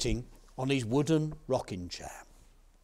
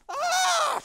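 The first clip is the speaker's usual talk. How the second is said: high-pitched storybook scream; farmer's scream; 6 dB louder than usual. Acted. Farmer hears a fish speak, high scream. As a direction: let out a high shriek. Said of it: "screamed the farmer".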